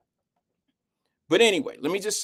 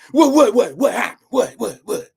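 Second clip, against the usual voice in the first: loud, sputtering mock whats; hammed-up imitation, jabbing each what; mimicking cynical sis; 5 semitones higher, 7 dB louder than usual. Streamer mocks cynical sis's reaction, exaggerated imitation. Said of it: "Y'all know she's cynical".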